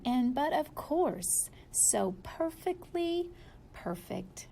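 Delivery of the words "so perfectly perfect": fading hush. A narrator's reading. Refrain fading out.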